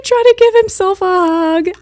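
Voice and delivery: high, loud heartbroken wail; distressed wail. Real, not acted. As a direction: cry it out, stretching the last word. Overcome retelling monkey hugging himself.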